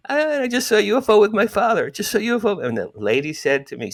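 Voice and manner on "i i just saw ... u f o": put-on much higher boyish voice, a little louder; thirteen-year-old voice. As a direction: play yourself at thirteen calling the paper. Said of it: "I'm doing my thirteen voice".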